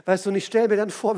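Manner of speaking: normal talking voice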